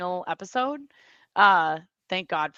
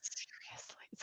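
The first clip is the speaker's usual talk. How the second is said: whispering; 23 dB quieter than usual. Real, not acted. Aside under co-host's talk.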